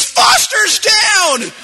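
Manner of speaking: high, loud, slow squad callout; battle shout. Retold or imitated. Squadmate calling out casualty, slow loud shout. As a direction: shout the man down. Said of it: "another voice in the thing goes".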